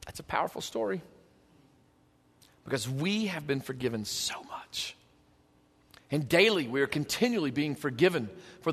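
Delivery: very soft and low, nearly whispered; solemn hush. Real, not acted. Preacher absorbing how much we are forgiven, solemn hush. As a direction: let it sink to an awed murmur.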